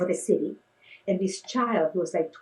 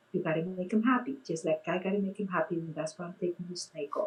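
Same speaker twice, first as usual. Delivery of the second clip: quiet to his ear; 2 semitones lower, 6 dB quieter than usual. Retold or imitated. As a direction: murmur it close to a child's ear. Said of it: "to his ear".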